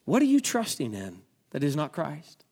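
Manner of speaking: usual speaking voice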